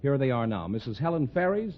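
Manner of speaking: ordinary delivery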